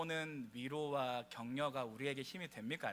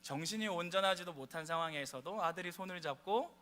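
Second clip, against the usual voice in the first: usual speaking voice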